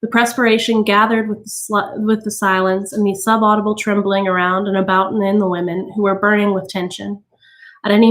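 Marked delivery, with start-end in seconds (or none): none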